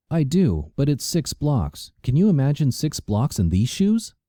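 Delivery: a pampered woman teasing; flirty city woman. A narrator's reading. Karina voiced by narrator.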